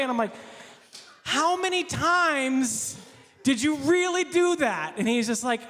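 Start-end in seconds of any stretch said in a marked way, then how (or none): 1.2-4.9 high, very slow disbelieving question; dubious slow interrogation; incredulous probing. Retold or imitated. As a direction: drag every word out in disbelief. Pastor grilling a kid.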